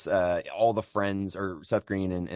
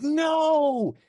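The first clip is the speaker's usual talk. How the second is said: high mock yell of panic; hammed-up anguished "No!"; a horror-comedy hero yelling on finding the hand gone; retold scream; 6 dB louder than usual. Devon Sawa finds hand gone.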